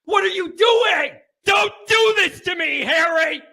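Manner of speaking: anguished shout at the TV. Acted. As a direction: yell it in despair. Clip of fans yelling.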